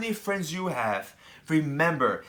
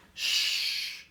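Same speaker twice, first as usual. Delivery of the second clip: soft breathy shh, barely any voice; shush; 5 dB quieter than usual. Acted. To the children, a quick library shush. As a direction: hush them like in a library. Hushing while reading about books.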